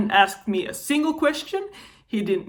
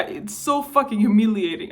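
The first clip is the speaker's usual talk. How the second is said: usual speaking voice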